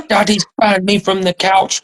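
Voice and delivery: mock toddler whine; whiny kid voice. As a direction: play the little boy telling on his dad. Dad retells son complaining.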